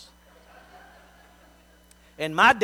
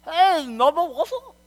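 put-on caught kid's sheepish greeting; 7 semitones higher than usual. Caught kid greets his mother.

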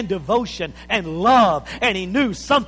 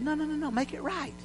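much softer, halting, sorry plea; repentant prayer; 4 semitones higher, 6 dB quieter than usual. Acted. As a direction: confess it quietly, stumbling over the words. Voicing a repentant sinner praying.

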